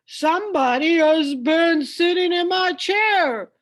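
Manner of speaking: big bear grumbling about his porridge; great big bear voice. Acted. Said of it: "in his great big voice".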